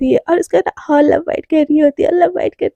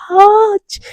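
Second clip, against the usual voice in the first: ouch; 6 semitones higher, 4 dB louder, much slower than usual. Acted. Lover bites her lips.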